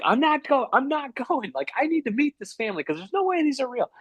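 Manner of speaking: high, whiny mock protest; desperate refusal. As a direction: dig in with hammed-up desperation. Acting out his refusal to go out.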